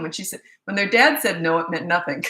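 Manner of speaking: usual speaking voice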